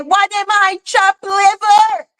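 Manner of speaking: high, drawn-out mock indignation; mock offended friend. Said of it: "she gonna say".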